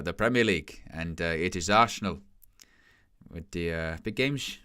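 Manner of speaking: a hammed-up Englishman's Dutch accent, full of pauses; mock Dutch accent. Streamer imitating Steve McLaren's Dutch-accented interview, mock Dutch accent. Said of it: "Steve McLaren Dutch accent".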